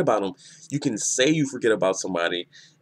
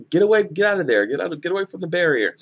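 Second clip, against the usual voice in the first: urgent warning shout; 7 semitones higher, faster than usual. Retold or imitated. Isane yelling at her comrades to get away from the barrier, high urgent shout. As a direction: shout it high and urgent, words tumbling fast.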